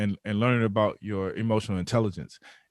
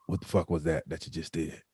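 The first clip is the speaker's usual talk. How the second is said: lower, raspy veteran growl; 6 semitones lower, 4 dB quieter than usual. Retold or imitated. Mike Anderson grabs him by the neck, low and raspy. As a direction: rasp it out like a grizzled vet grabbing a rookie. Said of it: "he got this real raspy voice".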